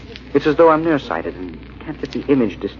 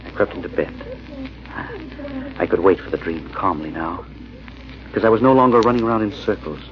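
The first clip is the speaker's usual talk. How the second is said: hushed, unhurried storytelling; low radio narrator voice, sinking softer toward sleep; story narration; 4 semitones lower, 4 dB quieter than usual. A narrator's reading. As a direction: draw the listener into the dream. Narrator bridges into dream.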